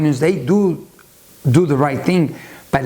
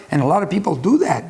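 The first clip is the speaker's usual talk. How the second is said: usual speaking voice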